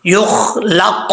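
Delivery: drawn-out Arabic "युखलक", full-mouthed consonants; full letters. Shown as a demonstration. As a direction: thicken kh and q in "युखलक". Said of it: "अपुर पढ़ा जाएगा".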